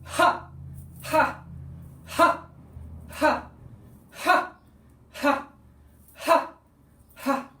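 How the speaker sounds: loud, high ha punched out with each movement; forceful ha exhales. Shown as a demonstration. Showing a combo of the exercise, forceful ha shouts. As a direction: run of evenly spaced explosive "ha" breaths. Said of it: "You can do a combo".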